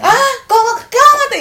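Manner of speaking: high, loud mock yell of an upset mother. Mom yelling at her daughter about the boss, loud and high. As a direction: shout it like a fed-up mom.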